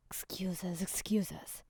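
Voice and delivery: low, soft dismissive mutter; dropped-voice grumble. A narrator's reading. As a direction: mutter it under your breath. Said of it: "he muttered".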